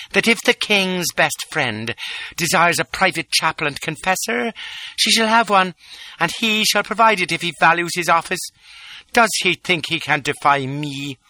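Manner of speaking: a hint of haughty royal scorn; contemptuous Lola. A narrator's reading. Lola scolding the chap, mild contempt. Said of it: "husky voice charged with contempt".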